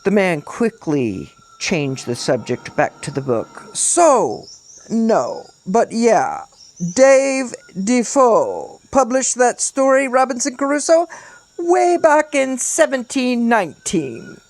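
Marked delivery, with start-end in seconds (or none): 3.7-14.4 high, declaiming storyteller's character; theatrical castaway holding forth; pompous lecturing castaway. A narrator's reading. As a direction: lecture grandly in a high, put-on voice. Figure in story lectures the boys.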